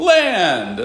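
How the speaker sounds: loud, high, drawn-out call falling away; emphatic loud answer. Shown as a demonstration. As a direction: belt out the answer to rouse a timid class. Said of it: "Say it louder".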